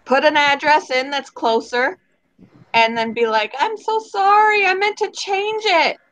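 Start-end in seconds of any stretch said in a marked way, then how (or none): 3.6-6.0 high, slow, syrupy mock apology; fake apology. Customer excusing a wrong address, high fake apology. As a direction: feign innocence in a high drawn-out voice.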